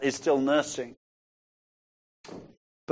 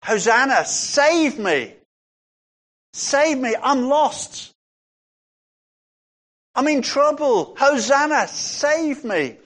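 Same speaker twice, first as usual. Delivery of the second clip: high-pitched cry for rescue; acted anguished plea; 8 semitones higher than usual. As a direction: plead as if lost and in trouble, pitched high. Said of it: "really it's an anguished prayer".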